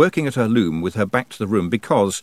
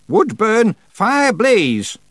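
witch shouting a spell, higher and louder; shouted spell; 12 semitones higher, 6 dB louder, much slower than usual. A narrator's reading. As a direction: command the fire loudly. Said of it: "she shouted".

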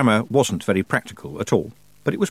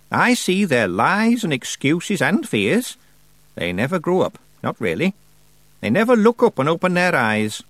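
put-on raised, deliberate voice of an imperious old witch; old witch Miss Treason; 5 semitones higher than usual. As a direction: play the grand old witch holding court.

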